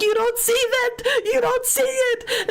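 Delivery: high, drawn-out tearful disbelief; mock panicked screaming. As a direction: act out a terrified, pleading child. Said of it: "I'm screaming at this point".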